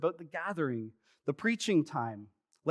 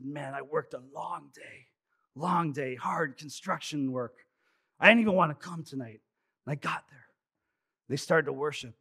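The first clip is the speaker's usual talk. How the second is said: a light hint of a raspy tired worker; tired construction worker recounting his day; raspy stranger. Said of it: "He had a very, uh, raspy voice".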